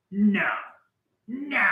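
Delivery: high-pitched kidding refusal; playful protest climbing very high; mock dismay. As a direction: squeal a joking objection. Mock objection to someone's favorite song.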